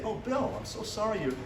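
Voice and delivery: soft, tender concern for Bill; quiet, kind and reassuring; gentle and caring. Pretend. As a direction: speak softly as if comforting a late client. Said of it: "if I had love in me".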